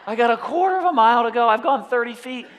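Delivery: high, loud, fast mock despair; exasperated outcry. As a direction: blurt it out in exasperated disbelief. Boy despairs at his slow progress.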